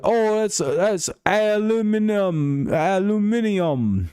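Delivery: high, slow, hammed-up British accent; mock the Brits in a drawn-out, high-pitched voice. Streamer mocking Brits over aluminium, high exaggerated British accent. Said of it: "They're like".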